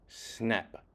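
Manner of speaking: ordinary delivery, nothing marked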